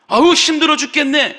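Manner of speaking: loud, high moaning sigh of a worn-out grumbler; exhausted moan. Retold or imitated. A grumbling Israelite moans to everyone, loud, high and fed up. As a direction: groan it out like someone fed up.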